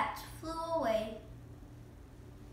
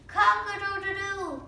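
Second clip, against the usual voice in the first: high loud rooster call; rooster crow; 7 semitones higher, 7 dB louder than usual. Pretend.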